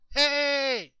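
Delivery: high, loud imitation of a call; mimicked holler. Chet mimicking the creature's call to DJ, hollering high. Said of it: "how come he kept saying".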